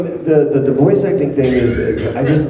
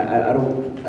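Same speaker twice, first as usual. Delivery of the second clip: ordinary talk, nothing marked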